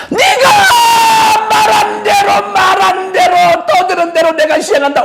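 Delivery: high, drawn-out thundering shout; booming voice of God. Acted. Pastor voices God warning the people, thundering shout. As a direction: proclaim it like God's own warning.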